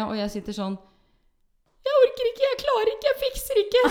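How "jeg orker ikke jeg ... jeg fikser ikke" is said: very high, loud protest; mock desperate squeal. Birthday girl suffering through the whole song, mock-desperate squealing. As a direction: rattle off complaints in squeaky panic. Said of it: "Sånn sier jeg gjennom hele sangen".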